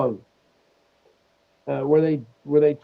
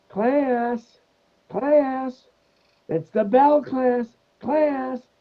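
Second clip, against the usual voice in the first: high, drawn-out nun voice; schoolteacher nun; 11 semitones higher, much slower than usual. Retold or imitated. Nun in a comedy sketch hushing her class, high mock calling. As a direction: call the class to order in a high sing-out. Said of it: "and then this nun, she goes".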